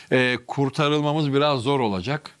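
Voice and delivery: normal talking voice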